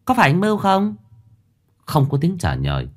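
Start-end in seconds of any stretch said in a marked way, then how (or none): 0.1-0.9 put-on a higher timid girl's question; lightly voiced girl. Daughter Nhài asking if it's her brother, lightly voiced girl.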